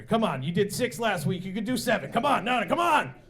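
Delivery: coach-style urging, building to a shout; acted egging on. Acted egging on of eating contestant.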